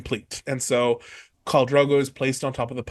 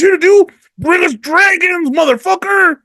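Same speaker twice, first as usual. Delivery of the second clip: high, loud, exultant yell; hyped shout; 13 semitones higher, 5 dB louder than usual. Real, not acted. Telling friends the story's big payoff, shouting with glee. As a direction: let it explode at the climax.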